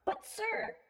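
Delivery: high, timid protest; nervous high-voiced underling. A narrator's reading. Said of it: "one of the men nervously replied".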